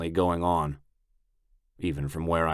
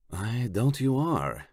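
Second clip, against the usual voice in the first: put-on Italian accent; 6 semitones higher, slower than usual. A narrator's reading. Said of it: "has a thick Italian accent".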